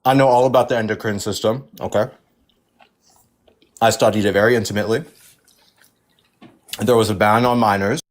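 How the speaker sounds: talking while chewing. Real, not acted. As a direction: talk through a mouthful. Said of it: "you're literally chewing".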